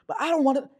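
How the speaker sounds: very high, very loud mock protest. Man matching her energy in an argument, high-pitched. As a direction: escalate to a squealed imitation. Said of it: "raising your voice in a higher pitched".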